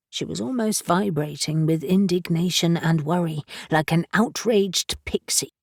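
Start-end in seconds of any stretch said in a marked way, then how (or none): none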